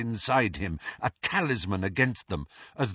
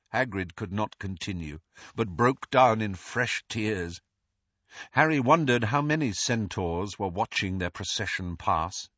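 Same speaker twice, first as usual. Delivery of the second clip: plain reading voice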